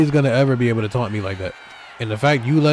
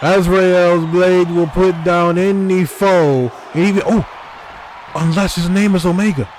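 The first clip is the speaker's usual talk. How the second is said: hype announcer call; 3 semitones higher than usual. Acted. Dramatic commentary call.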